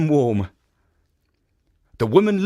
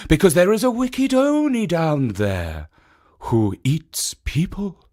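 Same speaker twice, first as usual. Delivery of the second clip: lightly voiced statue; 4 semitones higher than usual. A narrator's reading. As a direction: warn her kindly, just a touch higher. Second statue warns her.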